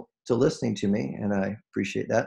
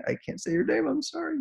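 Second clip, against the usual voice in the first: much higher, on the edge of crying; choked up; 5 dB quieter than usual. Real, not acted. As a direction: voice breaking high with tears. Moved while naming a listener.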